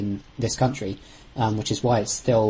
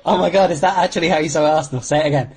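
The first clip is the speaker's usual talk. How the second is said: laughing, higher-pitched astonishment; amused disbelief; 5 semitones higher, 4 dB louder, faster than usual. Real, not acted. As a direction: ask it quickly through incredulous laughter. Laughing at co-host's pronunciation.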